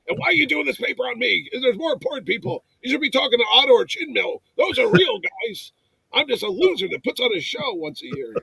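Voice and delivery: high-pitched, exasperated self-deprecation; hammed-up fussing, pitched well up; dad-mode protest. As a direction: argue it like a flustered dad. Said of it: "I turned into a dad Mitch".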